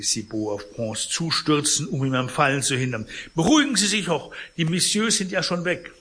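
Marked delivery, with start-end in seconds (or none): 3.3-5.9 high, loud fussy protest; concierge voice. Acted. Concierge protests to Pons, loud and fussy. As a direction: act the flustered concierge.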